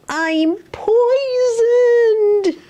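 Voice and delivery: dramatic cry. Shown as a demonstration. Parent shows the child how to say it dramatically, slow loud wail. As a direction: ham it up as if poisoned. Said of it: "You're not being very dramatic today".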